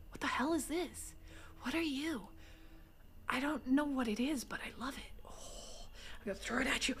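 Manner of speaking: soft whispered wonder; hushed whisper. Real, not acted. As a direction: keep your voice down. Whispering in store.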